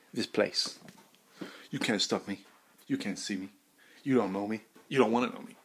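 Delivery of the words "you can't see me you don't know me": usual speaking voice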